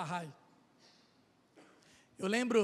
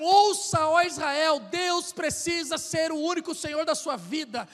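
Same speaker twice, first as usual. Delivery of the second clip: proclaimed scripture; 3 semitones higher, 7 dB louder, slower than usual. Acted. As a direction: declaim it like a prophet crying out. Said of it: "se não tiver um".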